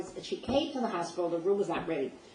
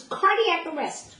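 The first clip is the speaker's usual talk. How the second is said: high, loud, slow alarmed scream; 11 semitones higher, 7 dB louder, slower than usual. Retold or imitated. Doctor raising the alarm in the hallway, screaming high and loud. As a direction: yell the alarm across the hallway. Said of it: "he screams".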